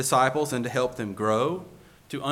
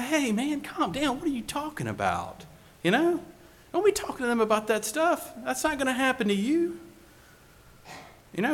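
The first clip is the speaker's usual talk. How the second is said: high, lightly voiced pal talking him out of it; friend reassuring; 9 semitones higher than usual. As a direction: play the buddy who brushes off the gloom. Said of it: "You would pull him aside and say".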